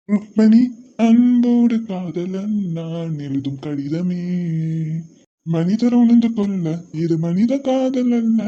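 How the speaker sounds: drawn-out lovestruck poem voice; romantic recitation. Acted. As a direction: savor each line like a devoted lover. Reciting a love letter.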